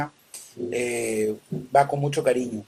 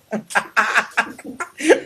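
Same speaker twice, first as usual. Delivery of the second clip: amused burst of higher laughter; laughing; 5 semitones higher than usual. Real, not acted. Host teased by the production team about his era, laughing. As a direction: laugh at the joke.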